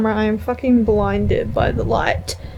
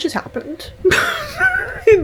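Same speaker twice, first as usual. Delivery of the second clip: giggling through the words; laughing out excitement. Real, not acted. Laughing while telling viewers what happened.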